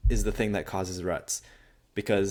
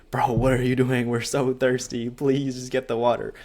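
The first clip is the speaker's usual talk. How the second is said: mock yell, higher and louder; half-playful exasperated yell in his head; inner scream; 4 semitones higher, 4 dB louder than usual. His inner voice begging himself to accept the water, in a mock yell. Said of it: "I was screaming".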